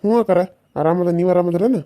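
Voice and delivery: loud called-out reply; calling back. Acted. Ramesh calling back a greeting to his sister, loud. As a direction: call it out across the distance.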